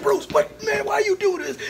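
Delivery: high, loud mock exasperation; exasperated scolding. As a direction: imitate frantic bodyguards scolding their boss. Bodyguards scold the star, retold.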